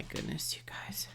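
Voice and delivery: breathless, voiceless whisper of awe; awed whisper. Real, not acted. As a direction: whisper it as if overwhelmed. Overwhelmed reader reacting to charm.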